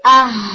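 آہ with a glottal start and breathy ساکن ہ at the end; ساکن ہا کی مشق; sukun demo. Shown as a demonstration. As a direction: stop on the ہ, just breath. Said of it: "اسی جگہ کو آپ نے یوز".

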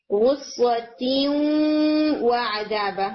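غصۃ و عذابا, the noon carried through the nose into و; nasal hum joining غصۃ to و عذابا; nasal ghunna merge. Shown as a demonstration. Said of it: "ناک میں آواز لے کر جائیں گے".

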